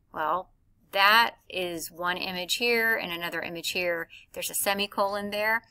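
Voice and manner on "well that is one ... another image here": usual speaking voice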